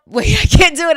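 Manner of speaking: loud, high laugh breaking the attempt. Real, not acted. Host breaking into loud laughter mid-accent. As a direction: start the line and crack up. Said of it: "what's my Southern accent?".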